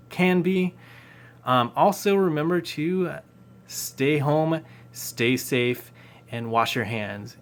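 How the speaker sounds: loud, upbeat presenter voice; YouTuber outro. Acted. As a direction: announce it like a channel host. Outro announcer read to viewers.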